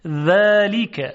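ذلیک with a thin, light dental dhal and long first vowel; delicate dhal. Shown as a demonstration. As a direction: say ذلیک slowly, tongue tip lightly at the teeth. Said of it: "صدا ظریف هست". (ذلیک با ذال ظریف و نازک)

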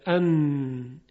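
a humming "ان" that resonates through the nose; nasal ghunna. Shown as a demonstration. Teacher demonstrating the nasal ghunna of nun, humming. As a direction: let "ان" ring in the nose. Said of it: "صدای نون غنه داره".

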